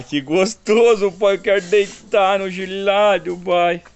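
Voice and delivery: high, slow dog voice, savouring the cold ground; a pleased pup sighing over the cool earth; voicing the dog. Father voices his dog loving the cold dirt, in a high, slow pet voice.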